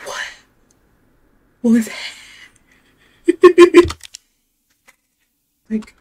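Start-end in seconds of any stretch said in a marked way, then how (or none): 3.3-4.2 sudden high, loud laugh of nerves; nervous high laugh. Real, not acted. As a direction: burst out laughing from fright. Nervous laugh at a game monster.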